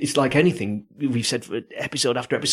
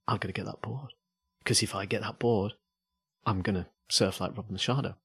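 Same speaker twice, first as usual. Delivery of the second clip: quiet daydream; 8 dB quieter than usual. Retold or imitated. Voicing a hopeful buyer.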